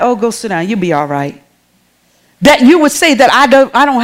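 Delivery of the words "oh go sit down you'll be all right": low, soft, offhand dismissal; unbothered aunt. Retold or imitated. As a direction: brush off a child's fuss, voice dropping. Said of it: "And I'm like".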